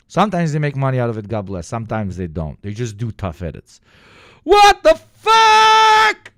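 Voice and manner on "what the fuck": very high, slow, loud drawn-out disbelief; stunned. Real, not acted. Streamer floored by a surprise donation, drawn-out shock. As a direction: stretch the shock out long.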